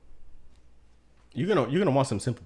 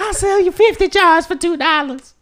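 very high, loud hammed-up old lady; Deary B impression; 11 dB louder than usual. Host doing Deary B again for his cohost, very high and loud. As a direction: squeal the bargain like a doting seller. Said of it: "asking you to do impression".

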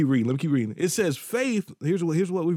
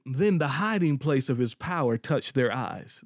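ordinary delivery

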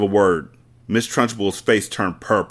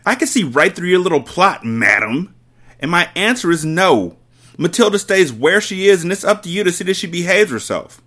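higher, louder flat refusal; domineering headmistress; 9 semitones higher, 5 dB louder than usual. Acted. Headmistress refusing Miss Honey, loud and high. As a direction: lay down the law.

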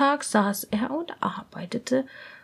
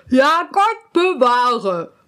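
slow, louder earnest vow; court ladies chorus; 6 dB louder, slower than usual. Acted. The ladies promise silence, slow and earnest. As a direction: swear it solemnly. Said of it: "sagten sie alle".

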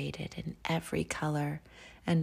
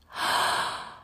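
breathy gasp of amazement; soft gasp. Acted. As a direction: gasp at the snowy world. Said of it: "And Noelle gasped".

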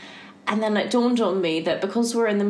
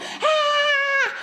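high shrill bird scream, mimicked; imitated curlew scream. Telling a friend how the curlew sounded, a high shriek. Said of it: "it just sounds like someone screaming".

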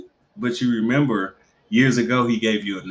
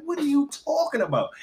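much higher baffled question; disbelieving mom; 13 semitones higher, 5 dB quieter than usual. Retold or imitated. Mother questioning her son from the driver's seat, baffled and high.

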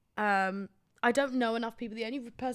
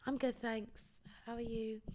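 soft polite reply; 9 dB quieter than usual. Retold or imitated. Her own polite reply in skit.

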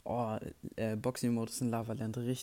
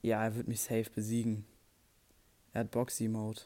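ordinary talk

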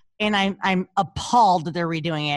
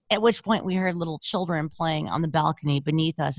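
ordinary talk

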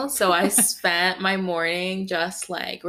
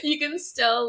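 laughing; 9 semitones higher than usual. Real, not acted. Keeps laughing while talking.

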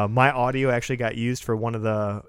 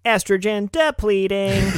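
toy robot voice; 8 semitones higher, slower than usual. Pretend. Robot announcement of the feminism month ending.

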